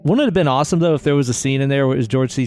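ordinary delivery

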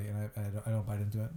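usual speaking voice

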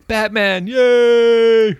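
high-pitched mock cheer, very loud; imitated yell. Repeating the little guy's cheer for Batman, high drawn-out yell. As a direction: yell like the little guy, stretching out the yay. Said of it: "little guy who yells".

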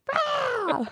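high laughing eagle cry; eagle screech. Retold or imitated. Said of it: "That's an eagle sound".

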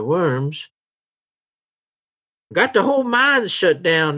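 put-on high-pitched old local with town gossip; old townsman, chatty. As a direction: play a high-voiced old townsman giving directions. Game master plays a townsman.

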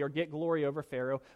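usual speaking voice

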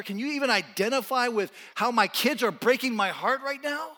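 loud, high, driving sermon delivery; full-force preaching. Acted. Preacher pressing his congregation with loud questions. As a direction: hammer each question at the congregation.

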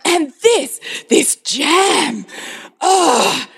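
high hammed-up exasperation ending in a groan; mock tantrum. Mother flinging groceries to outdo her cranky son, mock tantrum. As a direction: rage theatrically at the groceries. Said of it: "was having a tantrum".

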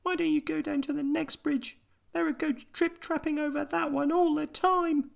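very high squeaky mouse; tiny mouse voice. A narrator's reading. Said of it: "said the mouse".

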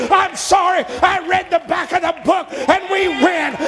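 high, building preacher shout; preaching at full force. Acted. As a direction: whoop it up to the rafters.

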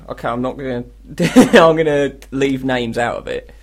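normal talking voice

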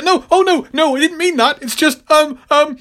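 high, flustered backpedalling; mock apologetic fluster, pitched very high; Spider-Man. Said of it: "And she's like".

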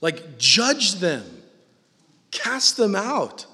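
ordinary delivery

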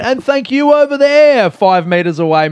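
high, loud shout to someone off to the side; calling out. Retold or imitated. Podcaster hailing the fan's mate standing apart, calling out. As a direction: holler it across the distance.

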